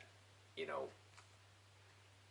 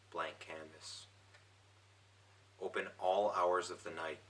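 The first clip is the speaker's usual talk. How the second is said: poetry reading; 5 dB quieter than usual. Acted. Poet reading his own poem to viewers, measured recital. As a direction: read it softly, letting each line fall away.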